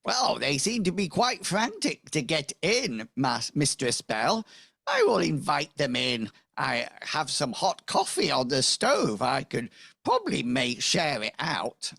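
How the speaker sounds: high, lilting housekeeper voice. Acted. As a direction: play a fussy housekeeper, pitch swooping up. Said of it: "hear your housekeeper".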